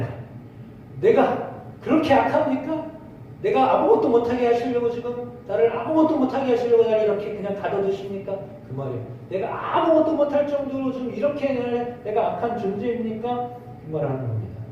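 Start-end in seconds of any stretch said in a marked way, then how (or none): 1.0-8.4 raised aggrieved plea; wounded protest to God; Job complaining. Retold or imitated. Voicing Job's complaint. 9.3-13.5 high aggrieved question; Job complaining. Retold or imitated. Voicing Job's complaint.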